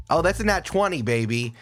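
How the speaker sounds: normal talking voice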